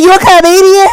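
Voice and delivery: high, loud mock excited man; dropped put-on voice. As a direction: imitate him squealing the question, high and loud. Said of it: "I knew you was putting on a voice".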